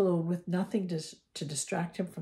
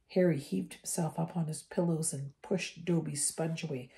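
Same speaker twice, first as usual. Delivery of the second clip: ordinary delivery, nothing marked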